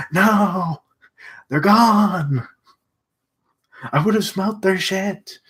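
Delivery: high put-on voice for Lanny; voicing Lanny. Voicing Lanny insisting the friends are gone, high and deadpan. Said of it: "Lanny's like".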